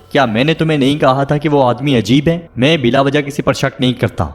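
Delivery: ordinary delivery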